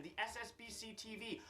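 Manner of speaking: ordinary talk, nothing marked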